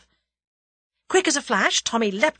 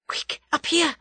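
put-on higher, urgent young woman's voice; urgent girl's call; 8 semitones higher than usual. A narrator's reading. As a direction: hurry him up the ladder. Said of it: "She pointed up a rickety ladder".

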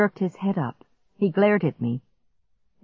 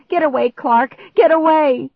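loud, higher desperate warning; panicked wail; 9 semitones higher, 6 dB louder than usual. A narrator's reading. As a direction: wail at someone to flee. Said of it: "I wailed".

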